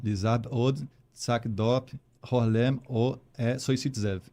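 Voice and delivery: saying Vestígios é o melhor podcast backwards, syllables reversed; phrase spoken in reverse, slow and deliberate; backwards speech. Shown as a demonstration. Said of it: "Fala, Vestígios é o melhor podcast". (fala ao contrário)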